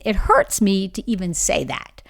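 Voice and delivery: ordinary talk, nothing marked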